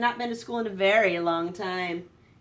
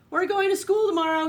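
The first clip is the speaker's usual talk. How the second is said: high, loud eager farmer; big news called to the pigs; excited announcement; 11 semitones higher, 8 dB louder than usual. Acted. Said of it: "he announced".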